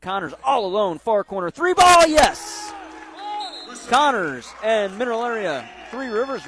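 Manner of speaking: rising to a full yell on the three; excited shout. Real, not acted. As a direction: yell it as the shot drops. Three-pointer made, shouting.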